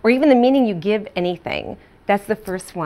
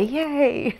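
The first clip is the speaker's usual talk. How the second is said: playful cheer; 6 semitones higher than usual. Acted. Mock cheer for small achievement.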